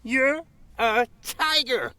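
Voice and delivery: high, accusing cartoon dog. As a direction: call him out like a pup.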